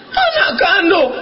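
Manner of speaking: high, emphatic mother's comeback; indignant retort. Retold or imitated. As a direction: answer back high and affronted. Mother retorts to son's doubt. (ibu menyahut dengan nada tinggi)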